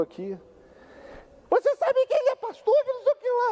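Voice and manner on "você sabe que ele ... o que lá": very high-pitched squeaky female mimicry; mock a woman's indignant protest in falsetto; imitating daughter-in-law. Imitates daughter-in-law's shrill protest. (imitando a nora num falsete bem agudo)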